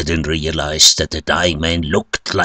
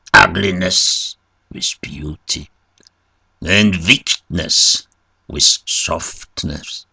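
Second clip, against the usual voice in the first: ordinary delivery, nothing marked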